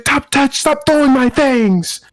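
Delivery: high, drawn-out mock shriek; high slowed girlfriend plea. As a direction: imitate her slow high demand. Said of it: "So she's like".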